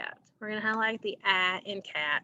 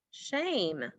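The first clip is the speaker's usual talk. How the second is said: usual speaking voice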